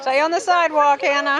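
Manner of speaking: loud call across the street. Real, not acted. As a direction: shout it so she hears. Calling to child on street.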